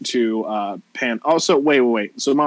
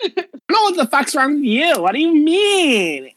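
put-on offended Valley girl. Valley girl rejecting a girl she liked, offended whine. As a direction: throw it back in disgust, high-pitched.

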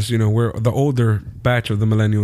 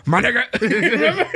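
laughing; 10 semitones higher, much slower than usual. Retold or imitated. Laughing high mock line.

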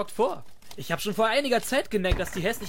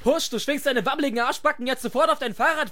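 ordinary talk, nothing marked

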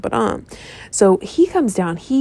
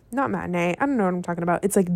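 usual speaking voice

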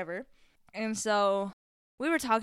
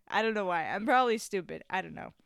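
usual speaking voice

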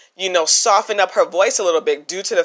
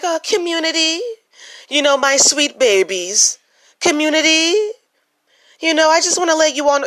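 phony sweet voice; 9 semitones higher than usual. Pretend. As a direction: ham up a fake-sweet advice giver. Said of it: "and I'm like".

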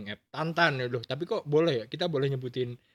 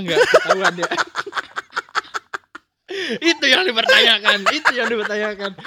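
talking through laughter; laughing; 7 semitones higher, 8 dB louder than usual. Real, not acted. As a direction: laugh while asking. Laughing at the story.

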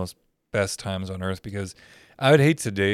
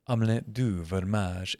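mock French tape; 2 semitones lower, 5 dB quieter, slower than usual. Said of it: "the tape's playing, and it's like".

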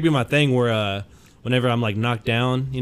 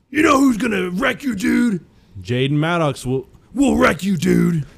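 high, loud wrestler promo shout; Hulk Hogan impression; 7 semitones higher, 4 dB louder, slower than usual. Cohost imagines his wrestler persona, shouting a loud high promo. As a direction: bark it at the crowd like a hyped heel. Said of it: "So he'd be like".

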